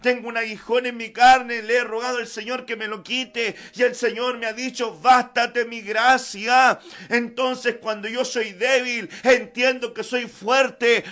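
loud, ringing pulpit voice; preaching at full force. Acted. Preacher urging the church to depend on the Lord, full force. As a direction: preach it out over the music.

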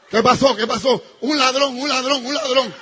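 loud, high alarmed cries; panicked yelling. Retold or imitated. Wife shouting to husband about a thief, loud panic.

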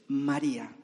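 low, gentle call by name; Jesus. Acted. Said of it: "reconoció el tono de voz de su maestro".

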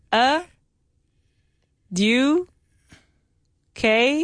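"A", "Du", "K" given one at a time with rising hints; feed out "A", "Du", "K" as separate, questioning syllables; syllable prompt. Shown as a demonstration. Teacher prompting student to finish the word, syllable by syllable. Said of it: "it starts with a".

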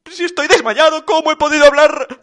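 high, loud bewilderment; baffled Papá Noel. A narrator's reading. Papá Noel puzzled at talking while fainted, high bewilderment. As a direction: protest the absurdity in a high voice.

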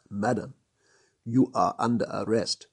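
usual speaking voice

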